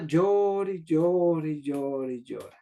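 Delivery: alargar cada «llore», apagándose poco a poco; retahíla cantarina que cae en tono y volumen; llanto interminable, narrado. Retold or imitated. Imita el llanto interminable de la niña. (llanto interminable, contado alargando las palabras)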